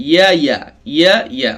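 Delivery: ordinary talk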